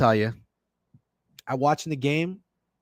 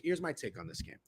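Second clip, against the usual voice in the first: hushed whisper; confiding whisper before a hot take; 15 dB quieter than usual. Real, not acted. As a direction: lean in and whisper it. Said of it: "whispered aside".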